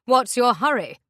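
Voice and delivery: loud mocking question; menacing taunt. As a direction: block them with a sneer. Captor blocks their way.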